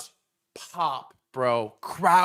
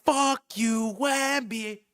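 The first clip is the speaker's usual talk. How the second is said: higher-pitched mock crowd chant; imitated crowd scream; 4 semitones higher, much slower than usual. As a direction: chant it like a whole city in unison.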